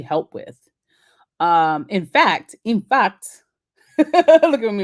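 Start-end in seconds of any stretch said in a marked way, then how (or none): 2.6-3.4 playful fake Nigerian accent, higher; a quick hammed-up Nigerian accent. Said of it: "my fake Nigerian accent". 3.7-4.8 laughing. Real, not acted. Laughing at her own accent.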